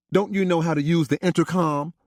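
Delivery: a disapproving question to the daughter; mother scolding. Acted.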